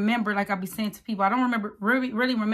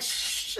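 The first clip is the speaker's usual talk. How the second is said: rustling shush; 9 dB quieter than usual. Retold or imitated. Mom rushing, grabbing her keys.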